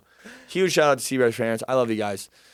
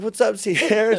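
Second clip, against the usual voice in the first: higher, forced cheerful hello; fake chipper; 9 semitones higher, slower than usual. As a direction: act all jolly to cover embarrassment. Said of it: "trying to be all chipper and jolly".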